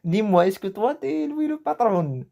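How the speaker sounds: high, mock-challenging question; acted challenge. Teacher acting out a challenge to a student, high mock voice. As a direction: put on a voice to challenge someone.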